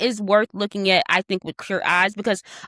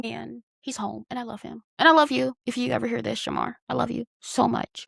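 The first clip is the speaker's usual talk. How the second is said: soft, tender and much quieter; 4 dB quieter than usual. Real, not acted. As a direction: speak softly from the heart about a beloved brother. Tender words about her brother.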